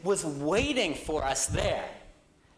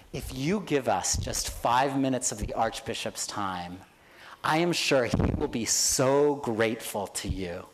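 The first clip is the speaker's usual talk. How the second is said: lower, honeyed sales pitch; smooth persuading voice; 9 semitones lower than usual. Retold or imitated. Nick sweet-talking the secretary over the intercom, lower and smooth. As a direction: charm your way past the gate.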